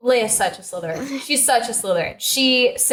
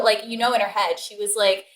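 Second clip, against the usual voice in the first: ordinary delivery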